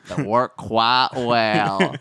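slow, loud and stretched out; mock tagline. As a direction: draw each word way out for comic effect. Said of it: "That's Kelly Dodd's new tagline".

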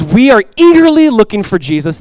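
Paul eagerly awaiting Jesus; eager quote. Retold or imitated. Paul longing for Jesus, eager lifted voice. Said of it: "He goes".

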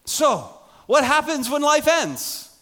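loud, high, slow rhetorical question; full-force preaching. Acted. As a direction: hammer the question home.

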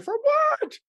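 very high indignant cry of disbelief; for what, squealed sky-high then shouted; outraged shriek. Retold or imitated. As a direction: shriek it, scandalized. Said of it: "I'm like".

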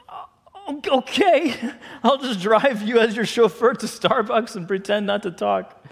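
high, stammered start into hurt sarcasm; stunned, defensive. Retold or imitated. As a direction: play up wounded, mock-agreeable indignation. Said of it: "I was stunned, I was hurt, I was defensive".

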